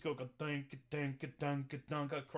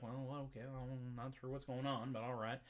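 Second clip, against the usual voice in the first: mule voice; 6 semitones lower, 9 dB quieter than usual. Retold or imitated. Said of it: "the mule's like".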